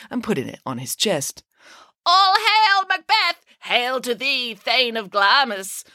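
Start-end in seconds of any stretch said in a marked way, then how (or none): 2.1-5.8 witch voice. A narrator's reading. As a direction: proclaim it like a witch. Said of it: "continued the witches".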